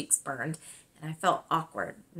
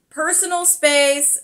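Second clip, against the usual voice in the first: put-on dry boy's protest; 9 semitones higher, 8 dB louder than usual. Boy wriggles out of hug.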